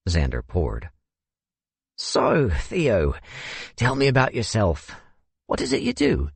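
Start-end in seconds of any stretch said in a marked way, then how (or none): 2.0-6.3 lightly voiced genteel older man; a hint of a South African gentleman. A narrator's reading. Narrator voices Xander.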